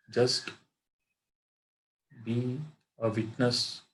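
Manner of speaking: measured meditation guide with long pauses; guided meditation. Acted. Teacher guiding friends to watch their breath, guided meditation. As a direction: lead them into stillness, phrase by phrase, in an even tone.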